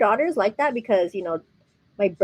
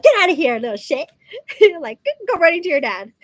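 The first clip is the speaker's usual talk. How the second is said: mock mom scolding; 7 semitones higher, 5 dB louder than usual. Imagined scolding, kid running.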